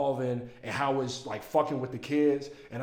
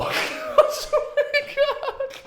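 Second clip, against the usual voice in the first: laughing; 6 dB louder, much slower than usual. Real, not acted. Laughing at his own joke.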